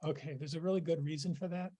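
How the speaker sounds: lost voice, straining to speak; hoarse voice. Real, not acted. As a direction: push words through a worn-out voice. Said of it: "I lost my voice".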